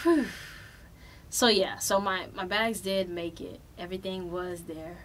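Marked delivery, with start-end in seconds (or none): 0.1-0.3 long breath out of relief; relieved sigh. Real, not acted. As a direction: blow out the tension. Relief after the ordeal.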